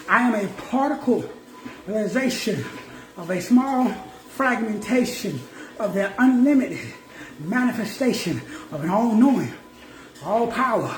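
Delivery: slowed right down for the crowd. Shown as a demonstration. Preacher slowing down so the back row gets it. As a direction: spell it out word by word for the back row. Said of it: "Let me say that slow".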